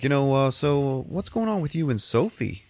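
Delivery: a hint of a probing doctor. Psychiatrist asking the patient about Sophie, lightly voiced.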